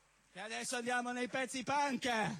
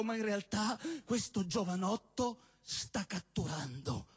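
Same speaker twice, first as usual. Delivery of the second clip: normal talking voice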